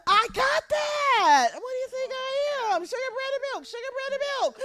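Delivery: very high, exasperated squeal; indignant overconfidence. Acted. Shopper protests being reminded of the list, indignant high squeal. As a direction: protest in a mock-offended high voice.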